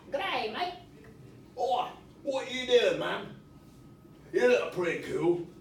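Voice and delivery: a cheery Australian bloke; Australian accent. Shown as a demonstration. Teacher demonstrating an Australian accent on the puppet. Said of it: "Maybe it's got an accent?".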